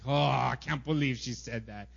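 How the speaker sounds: low, indignant disbelief; mock resentful grumble; growing anger. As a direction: let the stewed grudge start to boil. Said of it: "the anger actually gets worse".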